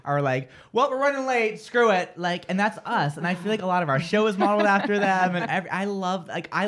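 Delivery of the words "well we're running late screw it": higher, brash shrug-it-off quote; mock a couldn't-care-less radio host. Radio fan quoting her favorite hosts, brash and higher.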